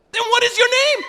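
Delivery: high, loud, exasperated nurse; imitated nurse demanding an answer. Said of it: "so she says".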